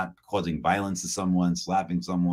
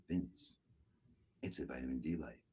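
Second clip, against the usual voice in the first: much softer, lower, eerily calm cult voice; 4 semitones lower, 18 dB quieter than usual. Retold or imitated. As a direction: say it softly like a true believer. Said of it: "as if she was in a cult".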